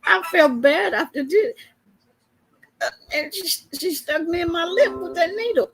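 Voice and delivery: ordinary talk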